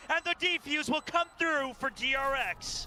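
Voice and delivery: high, fast hype play-by-play; esports caster. Acted. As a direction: call every kill with rising energy. Play-by-play of overtime round.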